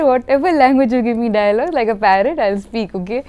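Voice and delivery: loud, high, playful retort; insistent self-quote, easing toward the end; retold defiant comeback. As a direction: fire back in mock protest. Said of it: "I said".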